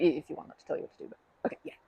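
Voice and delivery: usual speaking voice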